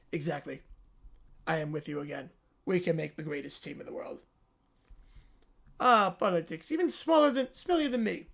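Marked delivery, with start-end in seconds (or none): 0.1-4.2 low, soft Trump voice; Trump impression. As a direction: mumble it like Trump rambling to the crowd. Trump voice again.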